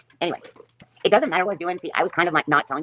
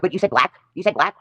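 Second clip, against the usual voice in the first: higher-pitched imitation of an insistent accuser; mock accusation; 5 semitones higher, 4 dB louder than usual. Acted accusation by loss prevention.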